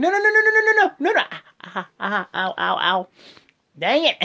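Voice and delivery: high, loud hammed-up cries of no; mock panic. Wrestling fan acting out Strowman's shocked rivals, mock panic. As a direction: squeal it like a cartoon panic. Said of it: "everybody's like".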